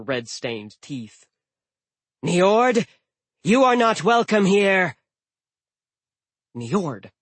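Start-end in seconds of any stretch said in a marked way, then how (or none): 2.2-2.9 high, loud furious call of the name Niord; angry shout. Acted. Giantess confronts the god. 3.4-5.0 high, loud hostile giantess; loud furious warning, higher voice; angry shout. Acted. Giantess confronts the god.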